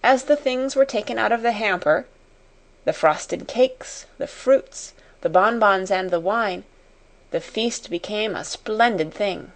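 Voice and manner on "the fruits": plain reading voice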